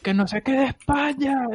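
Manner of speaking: high imitated neighbour's shout; mock far-off holler, voice pushed up; a gritos. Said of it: "A gritos". (imitando gritos de vecinos, en agudo)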